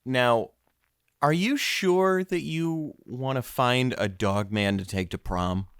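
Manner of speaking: ordinary talk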